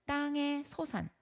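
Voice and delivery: "땅의 소산" drawn out slowly and high. Real, not acted. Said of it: "전사님이 강조하는 데는 이유가 있겠죠". ("땅의 소산"을 높고 느리게 힘주어)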